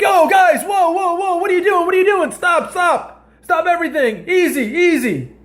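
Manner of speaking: high-pitched mock guard trying to break it up; security guards pulling apart a fight, high and frantic; panicked security guard. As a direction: squeak out stop, stop like a frazzled bouncer. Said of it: "they're like".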